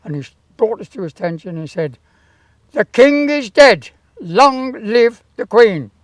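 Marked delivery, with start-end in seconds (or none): 2.7-5.9 slow booming proclamation, higher and louder; town crier. Retold or imitated. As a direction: proclaim it slow and loud like a herald. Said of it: "you'll repeat what I say".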